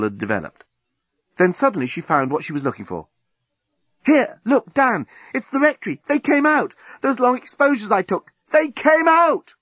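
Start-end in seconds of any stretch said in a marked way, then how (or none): 4.0-9.5 very high, thrilled cry; excited discovery. A narrator's reading. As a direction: burst out with it, pitched right up. Sudden excited discovery in photos.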